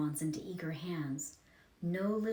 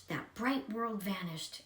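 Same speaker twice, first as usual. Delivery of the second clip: plain reading voice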